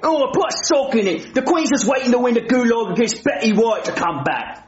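huffy put-on British accent; offended British accent; British accent, indignant. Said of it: "I don't like his accent".

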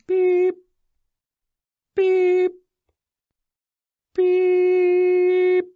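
high, drawn-out beeps; imitated keypad beeps. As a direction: tap out each beep like a keypad.

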